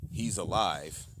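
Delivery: ordinary delivery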